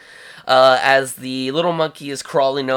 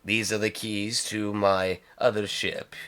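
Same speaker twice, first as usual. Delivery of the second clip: softer, lowered voice; confiding crime lord; 4 semitones lower, 6 dB quieter than usual. Acted. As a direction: offer it quietly, close by. Jabba confides, handing over keys.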